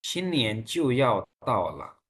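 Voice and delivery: slow, each syllable drawn out; slow pronunciation model. Shown as a demonstration. Teacher has class repeat the date, slow pronunciation model. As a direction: say it slowly for students to copy. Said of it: "pronounce कीजिएगा".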